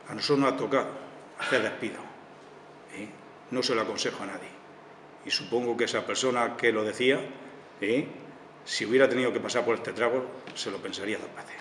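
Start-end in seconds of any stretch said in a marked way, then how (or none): none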